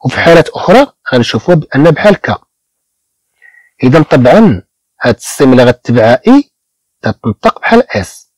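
ordinary talk, nothing marked

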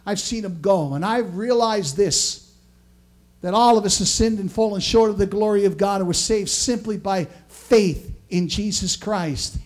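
ordinary delivery

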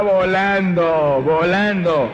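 slow, stretched-out exclamation; drawn-out words. Real, not acted. Preacher pictures the devil fleeing, long drawn-out words. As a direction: draw each word out as if it sails away.

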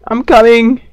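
high loud call to a teammate; shout. Real, not acted. Gamer shouting to his teammate that he's coming, loud call. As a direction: yell it as you run in.

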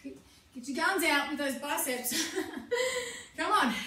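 ordinary talk

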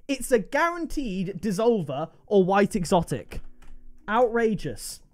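normal talking voice

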